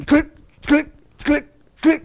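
high, loud mock clicking; clicking coaster. Narrator mimics the coaster climbing, high clicking. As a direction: tick it out like a coaster chain.

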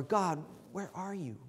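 hushed, humble question to God; soft, searching plea; respectful plea. Shown as a demonstration. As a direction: ask it softly and reverently, falling at the end. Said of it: "say that two different ways".

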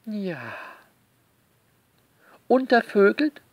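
ordinary delivery

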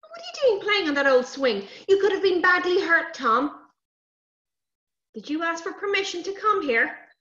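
cross father. Acted. Cross father questioning children, loud scolding. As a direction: tell them off loudly, voice lifting. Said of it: "Daddy was really cross with the children".

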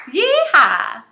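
loud whooping yeehaw; Texas cheer. Shown as a demonstration. Teacher leads the class in a Texas cheer, loud whoop. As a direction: whoop it out like a cowboy. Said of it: "Texas cheer, get ready, go".

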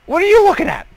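high, loud mock challenge; gruff enemy voice. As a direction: snap at the onlooker like a tough guy. Voicing a staring enemy.

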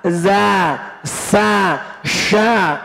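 normal talking voice

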